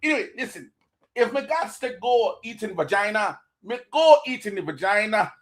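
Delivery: light mock Jamaican patois; playing up a Jamaican accent for laughs. Joking to stream viewers in a mock Jamaican accent. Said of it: "losing fucking viewers with the accent".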